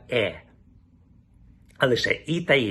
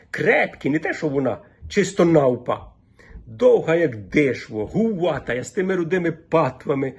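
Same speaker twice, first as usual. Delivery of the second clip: lively village woman in broad Volhynian dialect; 6 semitones higher than usual. Shown as a demonstration. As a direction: chat like a Volhynian village gossip. Dialect monologue before the lecture. (жвава балачка волинською говіркою)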